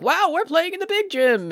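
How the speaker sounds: high-pitched mock amazement; awed player. Team marvels at the big gym, high mock awe. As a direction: gush in a high voice like a wowed kid player.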